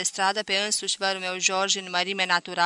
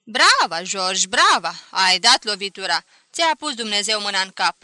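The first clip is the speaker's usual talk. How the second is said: bursting cheer; 10 dB louder than usual. A narrator's reading. Cousin Mișu cheering George's good luck, loud burst. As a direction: burst out in delight.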